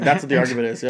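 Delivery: ordinary talk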